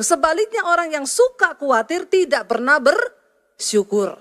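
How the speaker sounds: impassioned sermon, voice near tears; preaching at full force. Acted. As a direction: plead with the congregation not to worry. Pastor preaching, raised and emotional.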